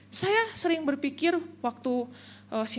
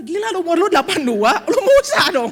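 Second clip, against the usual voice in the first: loud, laughing jab, rising higher; laughing tease; 4 semitones higher, 10 dB louder than usual. Real, not acted. Pastor teasing Dwi about age, loud laughing tease. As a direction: tease while laughing loudly.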